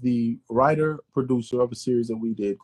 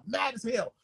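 normal talking voice

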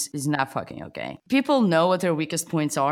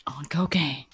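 unvoiced joking whisper; 4 semitones lower, 3 dB quieter, slower than usual. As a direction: whisper it like a secret. Whispered joke aside.

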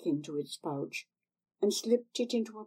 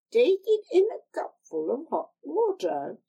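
put-on higher, wheedling old hag voice; old crone; 10 semitones higher, 6 dB louder than usual. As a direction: coax her like a sly old crone. Old woman gives instructions.

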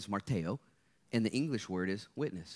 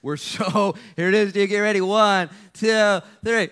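loud, high, hyped countdown; excited countdown; 10 semitones higher, 15 dB louder, slower than usual. Real, not acted. Pastor hyping the congregation for a selfie, loud and high. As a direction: pump the crowd up for the photo.

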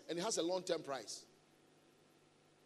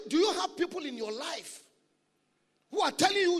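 ordinary talk, nothing marked

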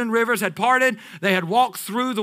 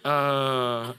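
laughing husband voice; 4 semitones lower than usual. Retold or imitated. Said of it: "you're like".

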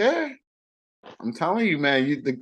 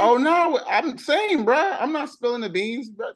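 usual speaking voice

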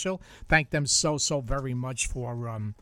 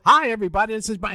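louder, higher on-air host greeting; radio announcer voice; 7 semitones higher, 6 dB louder, much faster than usual. Retold or imitated. Answering Gracie's call, repeats the mock on-air intro. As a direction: open the show for a caller as a joke. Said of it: "And I go".

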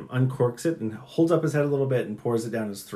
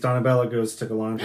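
ordinary talk, nothing marked

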